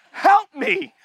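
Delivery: high-pitched mock cry for help; mock plea. Sinner calling on God for help, high mock plea. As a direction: squeak out a pretend plea.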